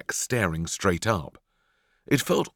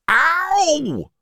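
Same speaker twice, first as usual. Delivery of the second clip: very high, loud yelp of pain; scream; 9 dB louder than usual. Acted. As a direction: cry out sharply in pain. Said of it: "he screamed".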